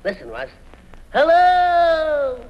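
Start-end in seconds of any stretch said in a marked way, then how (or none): 1.1-2.4 calling out. Acted. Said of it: "just listen to this echo".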